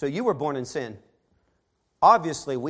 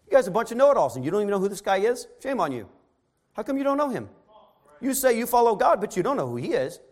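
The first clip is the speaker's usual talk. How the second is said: scolding; 6 semitones higher, faster than usual. Retold or imitated. Preacher scolding know-it-alls.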